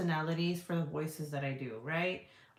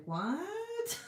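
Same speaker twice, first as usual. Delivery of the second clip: sudden high squeak of disbelief; shocked what; 9 semitones higher than usual. Real, not acted. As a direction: caught off guard, voice jumps up. Shocked by porn links.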